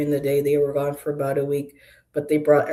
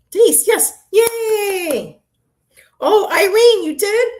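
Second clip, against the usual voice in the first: very high, loud gleeful cheering; delighted squeal; 9 dB louder, slower than usual. Real, not acted. Reader cheering viewers Irene and Denise with high delighted squeals. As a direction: squeal with joy at each name.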